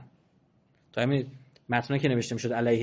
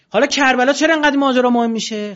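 much higher, much louder fiery sermon; preaching at full force; 11 semitones higher, 12 dB louder than usual. Acted. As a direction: thunder the question at the congregation. Preaching climax.

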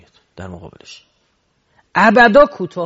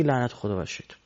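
hushed prayer against the devil; quiet muttered curse; 5 semitones lower, 9 dB quieter than usual. Shown as a demonstration. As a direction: softly say it under your breath. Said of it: "بعد بگی".

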